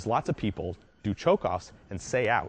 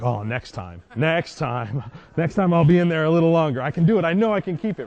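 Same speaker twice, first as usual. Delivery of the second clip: higher, louder, laughing dog's inner voice; eager dog voice; 7 semitones higher, 5 dB louder than usual. Retold or imitated. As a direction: play the dog, sure it will win next time. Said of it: "and they're like".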